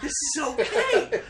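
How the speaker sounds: high, slow, laughing outrage; laughing disbelief. Retold or imitated. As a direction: laugh through exaggerated disbelief. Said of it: "I'm like".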